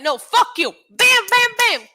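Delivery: high, loud defiance with blow noises; hammed-up combative yelling; mock attack shout. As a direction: shout out the hits like a play fight. Acting out Edge attacking Styles.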